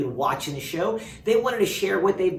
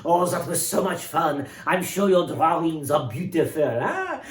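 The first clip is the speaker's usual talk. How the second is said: French chef voice, higher and bright; Jacques, French chef; 5 semitones higher than usual. Acted. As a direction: play a jolly French chef.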